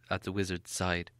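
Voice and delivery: plain reading voice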